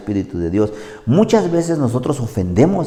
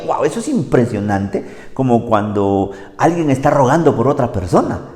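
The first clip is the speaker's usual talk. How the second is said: normal talking voice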